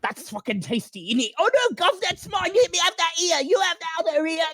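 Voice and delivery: high squeaky rats squabbling over food; greedy rats fighting over scraps, high; feasting rats. Acted. Rats squabbling over goblin parts, high squeaky voices. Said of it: "a hundred voices all together going".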